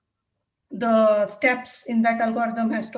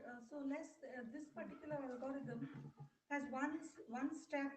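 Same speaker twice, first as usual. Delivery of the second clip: faint, far from the mic; very soft and distant; barely audible; 21 dB quieter than usual. Real, not acted. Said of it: "You, you're very low".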